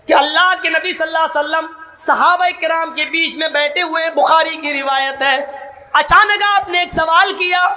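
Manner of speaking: full-force pulpit preaching with chanted Arabic; fiery preaching. Acted. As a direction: thunder the sermon at the congregation.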